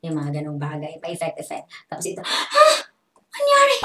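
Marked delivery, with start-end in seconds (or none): 2.2-3.8 high, loud gasp then shriek; hammed-up dramatic shock; mock shock. Shown as a demonstration. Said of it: "Like that". (OA na gulat at sigaw)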